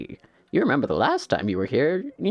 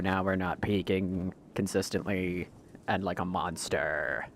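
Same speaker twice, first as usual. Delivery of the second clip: normal talking voice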